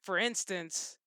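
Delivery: ordinary talk, nothing marked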